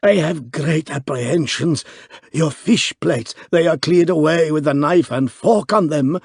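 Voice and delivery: high, worried foreign innkeeper; anxious innkeeper voice. A narrator's reading. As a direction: fret over the plates. Alarmed proprietor, foreign accent.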